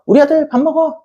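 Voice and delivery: high, sing-song call to eat; sweet call to son. Pretend. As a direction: call him to dinner sweetly through the curtain. Parent calling son sweetly. (아들에게 다정하게 부르는 높은 목소리)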